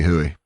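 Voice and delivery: low-pitched "Huey" said as HOO-ee; wrong "Huey" with no y glide; mispronounced Huey. Acted. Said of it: "I'm gonna call him Huey".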